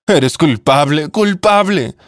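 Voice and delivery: grief-stricken widow's rising wail; anguished self-blame. Acted. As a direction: let the second word crack up high with guilt. Widow blaming herself in grief.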